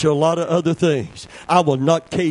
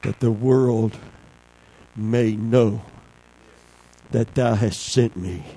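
low, slow, solemn scripture; scripture reading; 7 semitones lower than usual. Acted. Preacher reading Jesus' prayer to the church, solemn. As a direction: read the verse with reverence.

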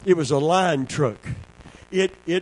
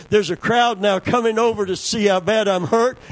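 ordinary talk, nothing marked

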